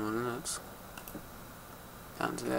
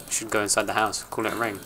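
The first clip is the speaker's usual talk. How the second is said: normal talking voice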